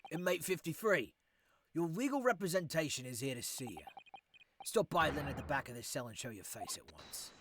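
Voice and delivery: loud, commanding warder voice; stern prison guard. Acted. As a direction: order the inmate out with authority.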